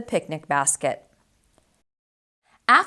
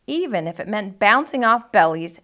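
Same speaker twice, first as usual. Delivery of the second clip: ordinary delivery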